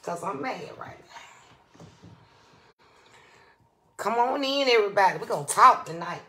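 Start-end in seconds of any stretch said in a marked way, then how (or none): 0.0-1.8 slow whispered confession; whispered aside. Acted. Host confiding to her viewers in a slow whisper. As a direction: whisper it slowly, like a secret.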